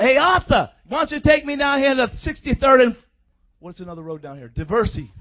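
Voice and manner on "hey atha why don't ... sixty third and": lively mock call to a cab driver; hailing a cabbie. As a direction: call out casually to your cabbie. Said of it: "the cabbie from the airport".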